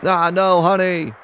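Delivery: high, loud wail of horror; acted horrified shriek; anguished cry. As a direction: scream out for your husband. Wife cries out as husband is attacked.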